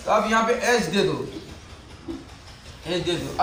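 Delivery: ordinary talk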